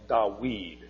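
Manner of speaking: Dawid drawn out as da-WEED; Arabic W pronunciation. Shown as a demonstration. Teacher showing the Arabic form of David, W sound. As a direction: say "Dawid" slowly with a W, not a V. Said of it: "It's a wa sound in Arabic".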